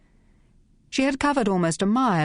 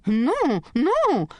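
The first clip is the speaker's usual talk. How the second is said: put-on high tipsy girlish protest; mock girlish protest, rising; giggly protest; 9 semitones higher than usual. A narrator's reading. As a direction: squeal it coyly as a tipsy girl. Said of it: "protested Sacha with another giggle".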